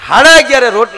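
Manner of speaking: sudden loud high burst; comic loud reveal; loud punchline. Acted. Punchline shout in joke.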